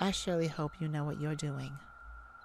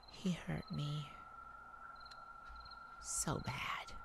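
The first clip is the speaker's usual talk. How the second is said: whispered, aching confession; soft, wounded hush; pained whisper; 10 dB quieter, slower than usual. Acted. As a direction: barely voice the hurt. Recalling painful past.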